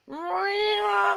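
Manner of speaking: high shouted cat meow; cat yowl. A narrator's reading. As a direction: wail it like a cat up a tree. Cat's terrible yowl in story.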